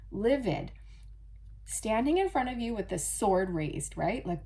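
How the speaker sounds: ordinary talk, nothing marked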